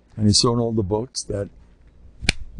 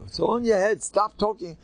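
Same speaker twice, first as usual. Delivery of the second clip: retold scolding; 9 semitones higher than usual. Rabbi scolding the sick young man, high mock yell. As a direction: snap at him to stop whining. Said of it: "I screamed at him".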